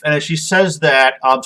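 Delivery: normal talking voice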